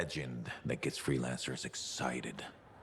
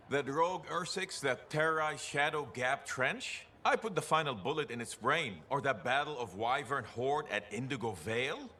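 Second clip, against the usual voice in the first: boastful bragging; 10 semitones higher than usual. Acted. Old veteran boasting of glory days.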